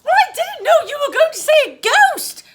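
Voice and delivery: put-on very high, loud, indignant girl; high-pitched, outraged defence; protesting. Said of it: "protested the girl".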